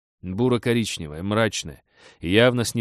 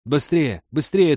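loud urgent shouting, higher; 7 semitones higher, 7 dB louder, slower than usual. Acted. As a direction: yell to hurry everyone along. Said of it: "торопила всех Мия".

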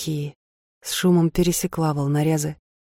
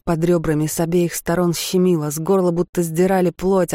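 ordinary delivery

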